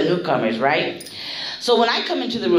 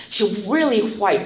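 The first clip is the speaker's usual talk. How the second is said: usual speaking voice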